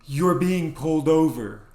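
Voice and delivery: deadpan it loudly; blunt announcement. Retold or imitated. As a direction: girlfriend bluntly warning driver. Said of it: "she just goes".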